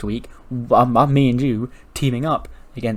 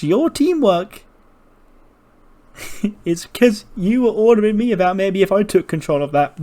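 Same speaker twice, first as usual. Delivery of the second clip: voice cracking high; 9 semitones higher, 4 dB louder than usual. Retold or imitated. Sammy snapping high-pitched at Kevin over the Rumble. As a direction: voice jumps high and strained mid-rant.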